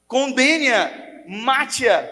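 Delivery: high, drawn-out crowd cry. Retold or imitated. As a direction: call for her death, slowly and loud. Accusers demanding her death.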